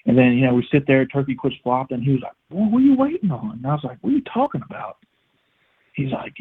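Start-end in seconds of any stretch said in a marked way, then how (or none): 2.5-3.6 higher-pitched retold disbelief; exasperated dad, retold. Dad scolding him in retold exchange. 4.0-5.0 high-pitched puzzled comeback; bewildered retort, retold. Puzzled reply to dad, retold.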